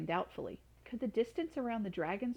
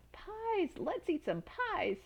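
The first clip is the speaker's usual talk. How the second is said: much higher, drawn out pies; cheering for a feast; joyful shout; 10 semitones higher, much slower than usual. Acted. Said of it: "shouted Circumference".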